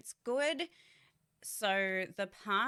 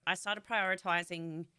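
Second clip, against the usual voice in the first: ordinary talk, nothing marked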